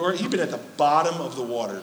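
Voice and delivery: ordinary talk, nothing marked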